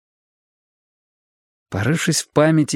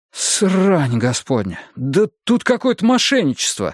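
exclaiming; 6 semitones higher than usual. Acted. Husband spots fraud in the bills, exclaiming. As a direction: cry out at a discovered fraud. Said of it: "воскликнул я".

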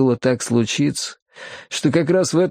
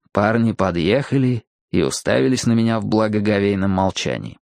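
usual speaking voice

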